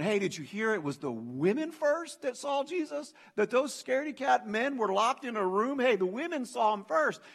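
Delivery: high, enthusiastic buzz of breaking news; excited gossip. Acted. Early believer passing on resurrection news, excited. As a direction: spread amazing news, pitched high and eager. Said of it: "Imagine the enthusiasm and the excitement".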